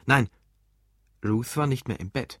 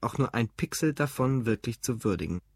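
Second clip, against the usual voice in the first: ordinary delivery, nothing marked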